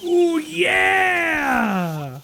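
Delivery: high, slow, loud cheering; excited yelling. Real, not acted. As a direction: whoop at the screen like a fan. Cheering a cutscene fight.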